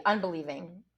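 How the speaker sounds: plain reading voice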